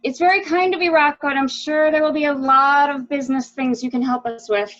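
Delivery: bright and loud, putting on a polite show; loud false politeness. Acted.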